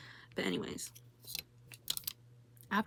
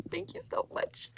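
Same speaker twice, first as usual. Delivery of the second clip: high, slow, gushing relief; drawn-out overjoyed thank you; 12 semitones higher, slower than usual. Real, not acted. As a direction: melt with exaggerated gratitude. Clerk voids the charge.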